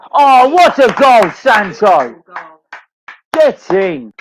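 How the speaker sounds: ecstatic goal celebration, loud and high; long goal yell. Real, not acted. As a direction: roar it like the ball just went in. Watching Sancho score live.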